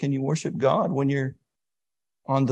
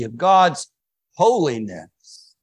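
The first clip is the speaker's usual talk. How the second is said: louder, with holiness drawn out slowly; stretched emphasis; 3 semitones higher, 7 dB louder, much slower than usual. Shown as a demonstration. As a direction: lean hard on holiness and stretch it. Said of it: "I can say it like that, and that makes it sound a little bigger".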